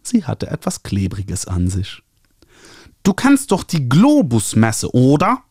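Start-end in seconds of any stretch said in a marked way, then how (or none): 3.0-5.4 high, nasal, gushing publisher voice. A narrator's reading. Publisher Ernie calling the author, high nasal gushing. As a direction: trumpet it through your nose like an eager publisher. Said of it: "anstrengendes Näseln".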